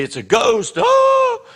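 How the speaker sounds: high panicked shriek of terror; frightened shriek. Retold or imitated. Disciples in the boat seeing a ghost, shrieking in fear. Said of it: "They say".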